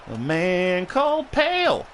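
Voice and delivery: high, drawn-out put-on voice; mock grand title. Streamer joking that Sheamus is pale, mock-grand call. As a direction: stretch it out like a silly title.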